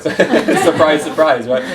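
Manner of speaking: laughing through a mock "surprise, surprise"; laughing sarcasm. Real, not acted. Joking to the audience about never going back, laughing. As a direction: tease it with a chuckle, dry and amused.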